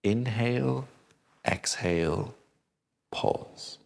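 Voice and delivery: counting out the triangle breath for students; breathing cue demo. Shown as a demonstration. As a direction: model the breath rhythm with your voice. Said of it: "this is how they breathe".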